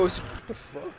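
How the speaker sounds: whispered shock. Real, not acted. After scream, shaken.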